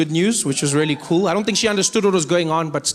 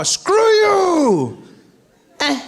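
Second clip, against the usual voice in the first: high, loud hang-up yell; mock angry shout; 12 semitones higher, 5 dB louder, much slower than usual. Caller shouting at the other end on a cell phone. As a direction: yell it before tapping end call.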